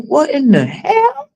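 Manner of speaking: high-pitched what in the hell; mock bewildered. Retelling her pain to viewers, mock baffled and high.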